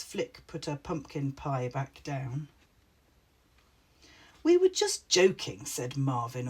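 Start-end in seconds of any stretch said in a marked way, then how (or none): 4.4-5.7 cocky, higher and louder. A narrator's reading. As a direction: brush it off, full of himself. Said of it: "all cocky".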